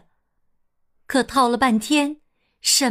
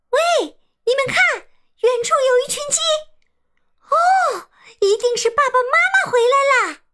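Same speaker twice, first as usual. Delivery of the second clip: high, fast joyful shout; excited shout; 14 semitones higher, much faster than usual. A narrator's reading. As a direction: yell with joy at the sight. Chicks spot parents returning.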